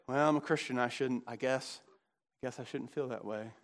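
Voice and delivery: low, soft, hesitant mumble; sugarcoated mock piety. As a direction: back down meekly, voice dropping away. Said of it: "Don't sort of sugarcoat it".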